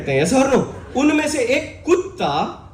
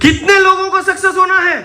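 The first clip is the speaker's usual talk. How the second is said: high, loud crowd-rousing shout; 10 semitones higher, 8 dB louder than usual. Real, not acted. Speaker demanding a bigger answer from the crowd, loud shout. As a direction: shout the question out to get a roar back.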